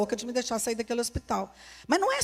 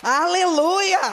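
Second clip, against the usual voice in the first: loud, high joyful shout of aleluia; shouted hallelujah; 6 semitones higher, 7 dB louder, much slower than usual. Real, not acted. Preacher shouting praise to the congregation, loud and joyful. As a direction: call it out to the whole church.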